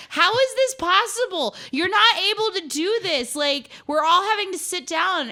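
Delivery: high, loud retold protest; indignant yell, half-laughing; mock outraged shout. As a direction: belt it out in disbelief.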